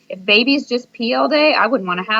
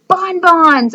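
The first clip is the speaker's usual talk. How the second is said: high, loud burst of a great idea; shout; 9 semitones higher, 7 dB louder than usual. A narrator's reading. Boy shouting his great idea to the class. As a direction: yell it out like a eureka. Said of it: "I shouted".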